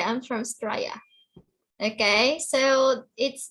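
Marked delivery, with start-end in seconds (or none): 0.0-0.9 Australia squeezed to Straya, syllables swallowed; Australian slangy Australia as Straya; Aussie clipped Australia. Shown as a demonstration. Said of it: "they just say".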